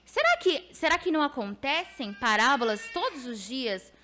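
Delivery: impassioned preaching over music. Acted. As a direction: lift into fervent preaching. Preaching voice of the Spirit.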